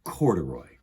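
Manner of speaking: ordinary delivery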